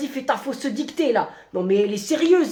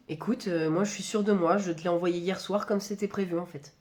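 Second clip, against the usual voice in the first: quiet reply; 6 semitones lower, 8 dB quieter than usual. Acted. Employee replying much softer.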